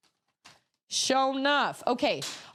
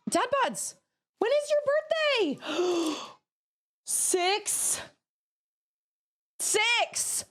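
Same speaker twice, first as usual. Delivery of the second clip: excited; 12 semitones higher, slower than usual. Real, not acted. Finding out it's a birthday.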